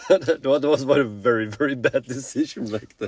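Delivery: a burst of laughter breaking into the words; laughing. Real, not acted. Father laughing at his own bad moving decision, rueful laugh.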